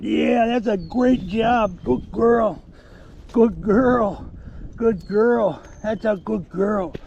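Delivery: high, loud gushing praise; praising the dog. Real, not acted. Handler praising his dog Sasha for finding the deer. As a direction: gush over her, pitch way up.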